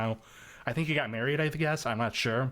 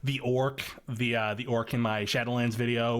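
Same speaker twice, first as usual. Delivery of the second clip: normal talking voice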